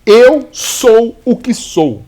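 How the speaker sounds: slow, solemn divine pronouncement; slow, weighty voice of God. Retold or imitated. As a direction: intone it slowly like God speaking. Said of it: "Então Deus fala assim".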